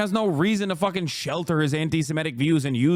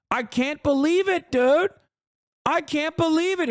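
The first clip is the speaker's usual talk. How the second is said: high, hammed-up fake shock; overdone mock disbelief, pitched way up; sarcastic mock alarm; 7 semitones higher than usual. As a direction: pretend to be terrified, mocking it. Mocking fake alarm at teenager.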